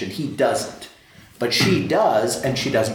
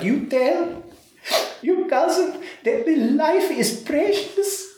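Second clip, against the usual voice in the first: imitating the crying lady, much higher and shaky with laughter; tearful plea. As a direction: squeeze it up high as if choked with tears. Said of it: "she started to cry".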